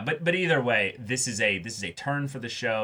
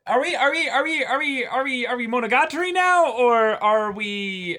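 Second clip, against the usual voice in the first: high, loud, stammering mock confusion; mock panic; 12 semitones higher, 4 dB louder than usual. Host joking the show turned into Monogatari, mock panic. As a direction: spin out in hammed-up bewilderment.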